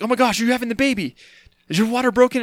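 high, fast, frantic questions; flustered new dad, high and rushed; panicky excitement. Retold or imitated. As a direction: blurt it out in a rush of panic. Panicked husband asks wife.

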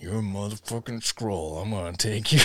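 quiet imitation of a tough threat; low mock threat. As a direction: menace someone under your breath, imitating. Acts out Fury's threat.

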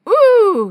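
high, loud "ooh" screech; startled high-pitched whoop. A narrator's reading. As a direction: shriek as the water hits. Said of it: "he screeched".

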